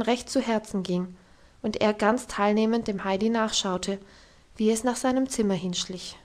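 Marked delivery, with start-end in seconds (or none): none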